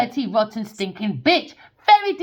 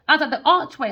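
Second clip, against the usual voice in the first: ordinary talk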